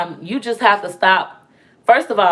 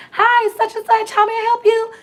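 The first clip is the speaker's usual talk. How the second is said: much higher, sweet phone-answering voice; customer service voice; 13 semitones higher than usual. Retold or imitated. Client answering her phone mid-session, high sweet greeting. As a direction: switch into bright professional phone greeting. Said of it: "she'll say".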